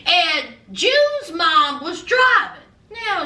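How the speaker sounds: usual speaking voice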